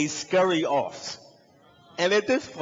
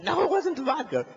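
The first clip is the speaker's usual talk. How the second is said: high-pitched flustered protest; mock-flustered friend, voice jumping up; 14 semitones higher than usual. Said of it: "He's absolutely flustered".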